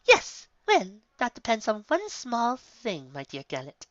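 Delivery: lightly voiced high, refined lady; a hint of a sly, posh mare; lightly voiced nonchalant unicorn lady; Rarity's voice. A narrator's reading. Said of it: "she muttered".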